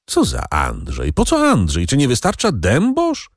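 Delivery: sudden high irritated questions; a touch of irritated outburst. A narrator's reading. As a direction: fire off the questions in annoyance. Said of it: "irytował się Edward".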